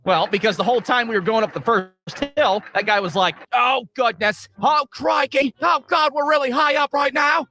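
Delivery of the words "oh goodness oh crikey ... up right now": hammed-up, badly faked Australian, pitched up; shitty Australian accent. Australian rider climbing the first hill, bad accent, mock alarm. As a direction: imitate a panicky Australian, badly. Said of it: "that's a shitty Australian accent".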